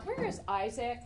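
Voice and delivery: ordinary talk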